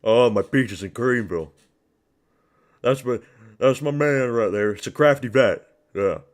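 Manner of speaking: loud gushing fan impression; lightly voiced delighted commentator; Lucas Tracy voice. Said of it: "Lucas Tracy is having an orgasm right now".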